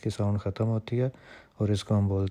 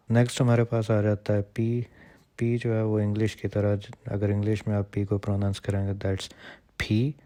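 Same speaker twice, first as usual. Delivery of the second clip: ordinary talk, nothing marked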